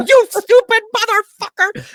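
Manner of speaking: imitating a sergeant's high, screaming outburst; full-blown retold yell, far above normal pitch. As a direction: scream the insult very high and loud. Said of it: "He just starts yelling".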